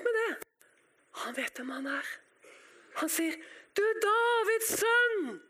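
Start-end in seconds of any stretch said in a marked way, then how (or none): 3.8-5.4 high, slow cry to someone passing; drawn-out beggar's shout; calling out. Acted. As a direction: holler it out, stretching each word. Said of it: "han roper".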